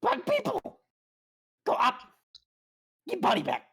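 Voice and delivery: squeaky broken creature speech; high goblin voice. Acted. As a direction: chirp it like a small goblin.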